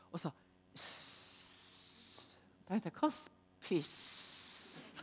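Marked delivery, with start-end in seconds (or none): 0.7-2.4 soft breathy hiss; unvoiced imitated hiss; mock hiss. 3.0-4.9 very slow whispered confusion; halting bewildered whisper; whispering her confusion at the odd sound; puzzled whisper. Retold or imitated. Puzzled at the hiss.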